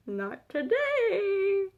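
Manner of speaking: drawn-out, high, soft singsong; playful singsong. Pretend. Vlogger putting off learning accents, playful high singsong. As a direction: stretch it out in a high, teasing lilt.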